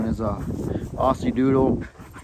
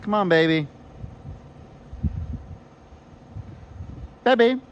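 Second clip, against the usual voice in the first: coaxing pet talk; 6 semitones higher than usual. Real, not acted. Calling the puppy over.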